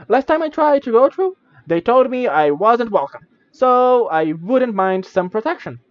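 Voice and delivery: a little sprite hinting it needs protection; tiny creature. Acted. Minimite asks the traveler for protection, high and chirpy.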